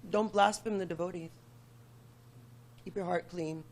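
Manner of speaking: ordinary talk, nothing marked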